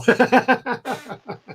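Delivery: high giggle at the nickname; high laugh. Real, not acted. Laughing at "call me anal.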